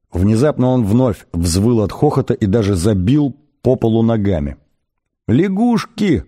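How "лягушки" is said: high-pitched delighted outburst; mock-wheezing thrill, pitched way up; wheezing excitement. A narrator's reading. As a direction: gasp it out high, as if overcome with hilarity. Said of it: "хрипел он".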